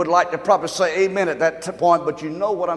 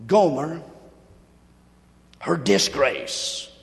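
ordinary talk

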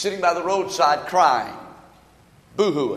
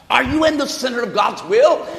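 ordinary talk

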